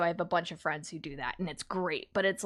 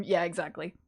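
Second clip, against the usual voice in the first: ordinary talk, nothing marked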